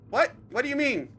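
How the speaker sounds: high, loud baffled protest; acted alarmed confusion; startled disbelief. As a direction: blurt it out in shock. Voices accused character reacting to captor.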